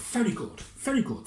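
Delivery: high, pleased fussy mayor; pompous mayor. Acted. Mayor approving the plan in a high fussy voice.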